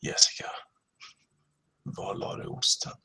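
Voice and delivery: whispered low Swedish line; sexy whisper. Shown as a demonstration. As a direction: breathe it out seductively. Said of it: "practice your sexy voice".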